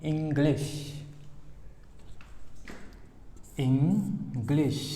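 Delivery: इंग्लिश stretched very slowly, lingering on the ng; slow model of इंग्लिश; drawn-out ng demo. Shown as a demonstration. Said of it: "यहाँ पे एक गा और रहता है".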